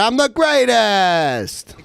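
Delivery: high, very slow boast; cartoon catchphrase. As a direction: stretch it out like a cartoon hero. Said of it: "the other one is".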